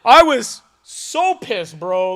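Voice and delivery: shouted high and loud, slow on the pissed; real indignant yell; loud outburst. Real, not acted. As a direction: blurt it out, still mad years later. Reliving anger at the job.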